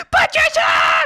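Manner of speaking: a sudden high shout of the name; a playful high call of Patricia; yelled name. Acted.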